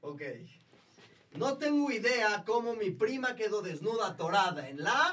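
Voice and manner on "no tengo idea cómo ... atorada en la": loud, high lead-in rising at the end; show-host cue. Pretend. Host prompting all to finish the sentence, sing-song call. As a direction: build up and leave the last word open. Host cues group chorus answer.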